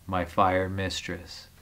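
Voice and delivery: soft, low, slow poetic aside; hushed aside. Acted. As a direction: let it sink low and slow, barely voiced. Dramatic reading of dark poem.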